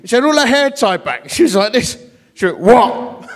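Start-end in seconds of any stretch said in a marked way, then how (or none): 2.6-3.3 barked what. Retold or imitated. Tough woman shouting at the vicar at her door. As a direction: snap a hostile "What?" at a stranger.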